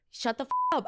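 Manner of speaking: retold defiant retort. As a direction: fire it back at the wife. Retold quarrel, answering the wife back.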